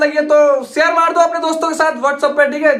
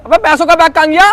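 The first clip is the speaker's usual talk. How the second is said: louder, higher panicked question; alarmed; 4 semitones higher, 6 dB louder than usual. Acted. Friend to friend over the missing money bag, alarmed. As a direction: demand it in sudden panic.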